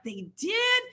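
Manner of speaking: surprised. Retold or imitated. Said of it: "And I'm like".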